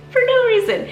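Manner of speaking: for no reason squeaked up high and loud; for no reason in a high, loud squeal. Real, not acted. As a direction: pitch the words for no reason way up in a teary squeal. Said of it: "crying for no reason".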